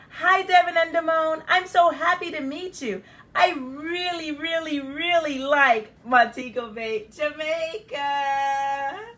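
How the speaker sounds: high, very loud, squealing with joy, Jamaica sung out slowly; overjoyed little girl. Acted. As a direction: gush with delight. Child overjoyed meeting cousins.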